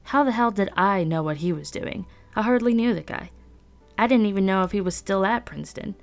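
plain reading voice

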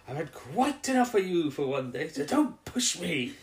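slow, stern sword-point threat; lightly voiced stern warning; Turlough threatening. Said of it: "he points at the Master and he's going".

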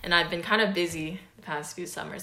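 ordinary talk, nothing marked

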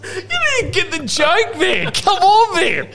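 high, laughing at his own punchline; cracking up at his own joke. Real, not acted. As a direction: laugh through the words, high.

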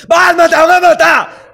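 high, loud mock warning scream; re-scream the warning slowly; retelling the passerby's shout at Batman; imitated scream. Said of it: "El bicho ve al Joker".

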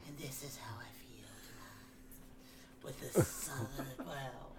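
slow, breathy whisper of a sultry heroine; sultry audiobook voice. Pretend. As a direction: whisper it like a seductive romance narrator. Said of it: "you're playing all the roles, including the female roles".

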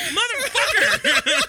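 high, drawn-out mock outrage; exasperated curse. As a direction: squeal the curse slowly, half laughing. Retold reaction to being turned down.

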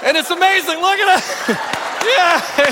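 high, loud, laughing hurrah; excited cheering. Real, not acted. Pastor cheering his volunteer's karate moves, excited and laughing. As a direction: cheer through laughter, pitch shooting up.

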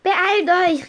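höher und lauter gerufen; über die Schulter gerufen. Retold or imitated. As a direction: ruf die Freundinnen zur Eile. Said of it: "rief ich über meine Schulter". (lauter, höherer Ruf über die Schulter)

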